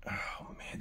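breathless whisper of awe; awed whisper. Real, not acted. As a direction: whisper it in wonder. Awed at silver box.

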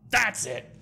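high, sudden acted outburst; hammed-up indignant cry; angry outburst. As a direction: burst out as an insulted cat. Insulted cat snaps, draws sword.

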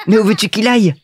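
put-on high, amused little-boy voice; amused Petit Prince. A narrator's reading. Little prince finds the pilot's fall funny, high and amused. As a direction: exclaim like a tickled small child.

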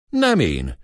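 usual speaking voice

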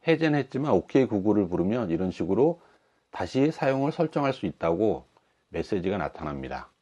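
normal talking voice

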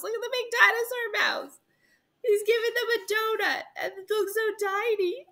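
very high, cutesy cooing voice; amused squeal. Real, not acted. Reader showing children the picture, high and amused. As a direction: coo over something tiny and silly.

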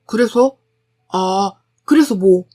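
brusque, indifferent retort; curt, gruff. A narrator's reading. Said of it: "라고 퉁명스레 대답만 했고". (퉁명스럽게)

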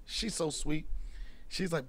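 ordinary delivery

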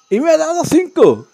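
high, loud, astonished exclamation; real thrilled disbelief; delighted surprise. Real, not acted. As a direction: burst out at an unexpected reward. Surprised by game reward.

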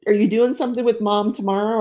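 usual speaking voice

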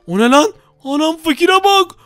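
high, loud astonished exclaiming; can't believe his eyes, shouting it up high; seeing the poor guy in armour, loud high amazement; shocked disbelief. Real, not acted. Rival shows up in diamond armour.

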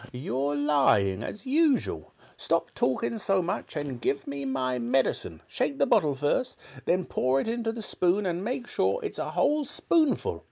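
put-on high, commanding old woman; grandma barking orders at George; bossy grandma. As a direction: order him about like a tyrant granny.

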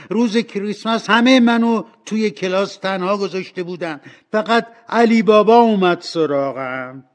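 high, loud, overjoyed exclamation; thrilled outburst at full voice; shouting with happiness. A narrator's reading. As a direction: cry out in delighted recognition. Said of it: "اسکروچ از خوشحالی فریاد زد".

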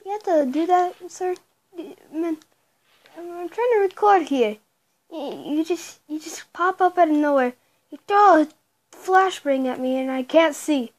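usual speaking voice

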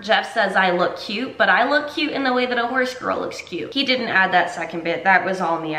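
ordinary talk, nothing marked

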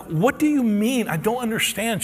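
can't believe what she said; high, slowed-down confusion; stunned disbelief. Retold or imitated. Stunned at free tuition.

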